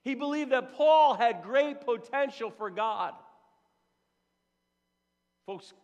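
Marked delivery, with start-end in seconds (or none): 0.0-3.1 slow, loud, high proclamation; slow, booming emphasis; emphatic preaching. Acted. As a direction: drive every word home from the pulpit. Preacher stressing the key word.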